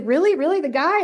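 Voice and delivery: high, incredulous double take; a friend's startled really, really; surprised disbelief. Retold or imitated. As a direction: ask it twice, pitch climbing in disbelief. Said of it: "She's like".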